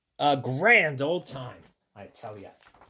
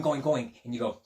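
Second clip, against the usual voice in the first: ordinary delivery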